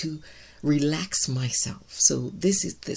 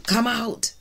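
commanding call; 6 semitones higher than usual. Retold or imitated. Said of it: "like Jesus said".

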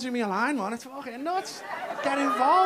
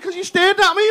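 wife's complaint; 11 semitones higher, 12 dB louder than usual. Retold or imitated. Wife's quoted complaint, high loud.

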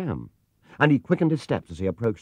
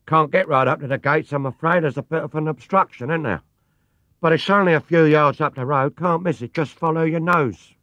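loud, higher London cabbie call; cheery cabbie; 7 semitones higher, 5 dB louder than usual. A narrator's reading. Taxi driver telling the bear they've arrived, cheerily. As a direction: announce the stop like a cabbie.

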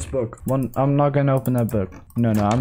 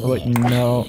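ordinary talk, nothing marked

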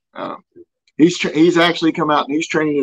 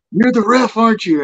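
higher-pitched, eager retold question; excited recognition; 7 semitones higher than usual. Mail carrier recognizes the ref on his route, excited recognition. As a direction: imitate someone thrilled to place a face.

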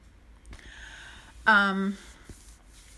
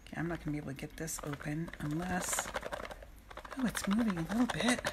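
muttered aside; 3 semitones lower, 8 dB quieter, faster than usual. Real, not acted. Muttered aside fighting a package.

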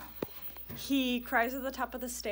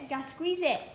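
ordinary talk

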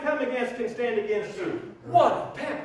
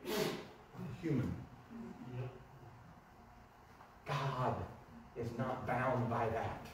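quiet sincerity; 10 semitones lower, 16 dB quieter, slower than usual. Real, not acted.

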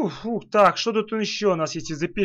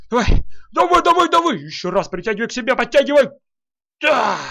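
excited urging; 5 semitones higher, 5 dB louder than usual. Real, not acted. Urging in game.

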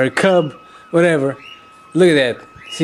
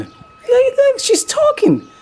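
much higher, slowed, thrilled look look; excited squeal; slower than usual. Real, not acted. Watching the mother bear talk to her cub, excited high squeal. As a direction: squeal it out in delight.

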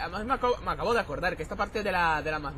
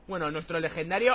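ordinary delivery

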